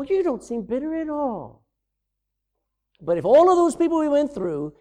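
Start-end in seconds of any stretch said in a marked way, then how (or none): 0.0-1.6 mock psychologist. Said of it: "psychologists look at you and go".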